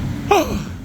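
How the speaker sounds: sudden high gasp of surprise; sharp gasp. Real, not acted. Vlogger gasping at the spooky crypts. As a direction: gasp as if startled.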